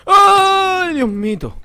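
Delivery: very high, loud shriek dropping off; real jump-scare shriek; startled scream. Real, not acted. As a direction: scream in sudden fright, then come down. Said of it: "qué sustito me ha dado".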